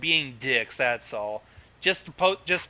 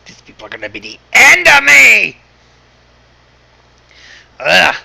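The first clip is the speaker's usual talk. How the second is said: much louder, higher mock despair; exasperated yell; 5 semitones higher, 15 dB louder than usual. Real, not acted. Host fed up with his guests, loud mock exasperation. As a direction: yell at the chaos, half joking.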